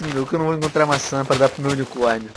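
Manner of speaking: normal talking voice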